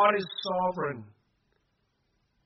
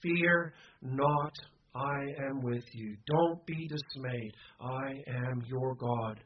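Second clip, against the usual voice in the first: slow, low, steady command; gentle but firm; 4 semitones lower, 4 dB quieter, slower than usual. Acted. God commands his people not to fear, gentle but firm. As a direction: soften it and lay each phrase down slowly. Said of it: "in a gentle but firm voice".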